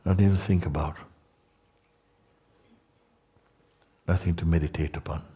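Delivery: hushed meditation voice with long pauses; guided meditation. Real, not acted. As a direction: guide them into emptiness, leaving long silences.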